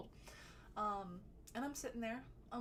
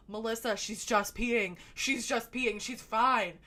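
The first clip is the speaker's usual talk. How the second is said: louder, slow, pleading reassurance; 12 dB louder, slower than usual. Retold or imitated. Friends loudly reassuring crying Melissa that Emily is fine. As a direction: call it out over her sobbing to calm her.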